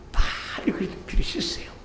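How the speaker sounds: high, hushed whisper; urgent whisper. Acted. Pastor pleading with sinners in a hushed whisper.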